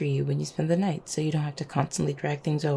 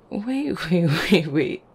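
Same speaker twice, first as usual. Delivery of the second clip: repeated, laughing call to halt; laughing protest; 5 dB louder, slower than usual. Acted. Halting a partner over a jacket, laughing protest. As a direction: stop them while breaking into a laugh.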